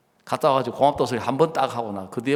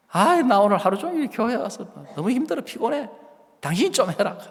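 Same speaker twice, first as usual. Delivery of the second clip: whiny wife; 8 semitones higher than usual. Retold or imitated. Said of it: "자매가 와가지고 하는 이야기가".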